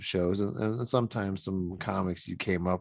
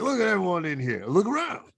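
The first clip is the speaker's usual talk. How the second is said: higher, louder comedian voice; Redd Foxx impression; 9 semitones higher, 3 dB louder than usual. Host imitating a comic working the room, higher and louder. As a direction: bark it out like an old-school club comic. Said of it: "your impression of Red Fox".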